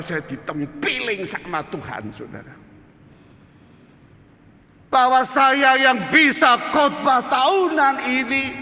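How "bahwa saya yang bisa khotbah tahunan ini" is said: high, loud, slow confession; full-force preaching. Real, not acted. Preacher admitting he threw God away, impassioned shouting. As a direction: cry out each admission at full volume.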